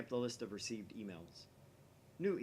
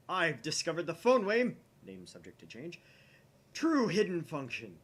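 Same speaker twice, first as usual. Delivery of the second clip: dramatic declaration; 6 semitones higher, 10 dB louder than usual. Acted. Okabe reveals the phone wave's function, loudly. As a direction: declare it with grand triumph.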